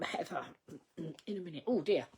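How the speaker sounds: ordinary talk, nothing marked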